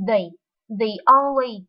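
"The only" with a long thee; "The only" with thee, not thuh. Shown as a demonstration. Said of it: "đọc chữ the thành chữ".

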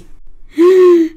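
sharp, high, tearful gasp of shock. Acted. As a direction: gasp as the horror hits. Mohamed sees what he did. (شهقة صدمة باكية)

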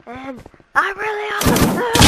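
loud, high gamer shout; high-pitched boast breaking into a cry; boastful yell. Real, not acted. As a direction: yell it out mid-firefight. Boasting while firing in game.

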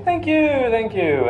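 high-pitched mock gratitude; gushing thanks. Americans thank the French for congratulations, gushing in mock gratitude. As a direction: gush thanks in a high put-on voice.

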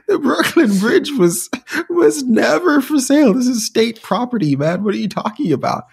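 high, loud mock indignation; exasperated police officer. Police setting a duped bridge buyer straight, mock exasperated. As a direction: protest as a baffled cop, voice pitched right up. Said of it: "police who were like".

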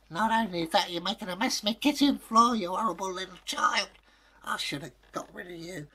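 high, quick nagging mother voice; a mother grumbling about the mess; scolding mother. Acted. Mother nags the dead girl about her kitchen floor.

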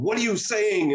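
high-pitched yell without extra volume. A narrator's reading. Student Shoju retorts to angry master, mock yell. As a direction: throw it back, pitch way up. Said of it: "Shoju yelled".

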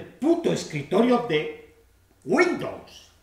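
worked-up storyteller, appalled and emphatic; exasperated outrage. Acted. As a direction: rail against the intrusion, voice jumping up. Outraged as Windows desktop invades view.

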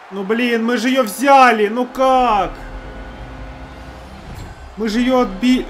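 loud, high annoyed complaint; frustrated outburst. Real, not acted. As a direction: protest the goal in frustration. Frustrated after conceding goal.

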